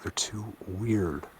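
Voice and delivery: slow, soft whisper. Real, not acted. Host whispering to viewers about the strange things, slow whisper. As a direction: breathe it out like a secret. Hushed aside in forest.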